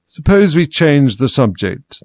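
slow yawning voice. A narrator's reading. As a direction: say it mid-yawn. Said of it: "interrupted, yawning".

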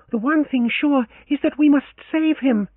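put-on high, passionate young woman; Leoncia, urgent. A narrator's reading. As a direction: press them to act now.

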